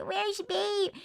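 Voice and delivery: put-on high, soft baby-talk call for the dog; cutesy pet call. Missing her dog, calling it.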